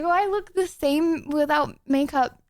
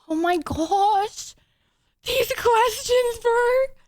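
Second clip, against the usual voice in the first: exasperated; 8 semitones higher, much slower than usual. Real, not acted. Reacting to chat questions.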